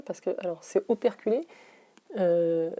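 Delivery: ordinary talk, nothing marked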